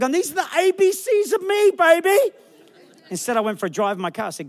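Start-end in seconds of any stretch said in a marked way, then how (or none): 0.0-2.2 very high and louder, defiant swagger; cocky retort. Retold or imitated. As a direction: belt it out with mock attitude. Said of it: "I felt like".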